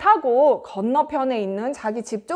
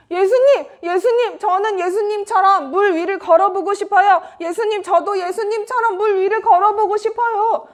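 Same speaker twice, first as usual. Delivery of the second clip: high, excited plea; eager calling; 7 semitones higher than usual. Acted. Peter asking Jesus to let him walk, high eager calling. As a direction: call out like an eager disciple.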